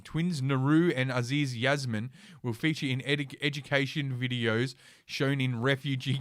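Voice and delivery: ordinary talk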